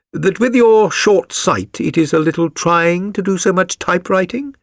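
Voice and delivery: lightly voiced Holmes, curious; a reader's hint of a shrewd detective. A narrator's reading. Holmes probing his visitor, lightly voiced.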